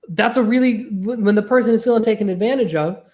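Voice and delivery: ordinary talk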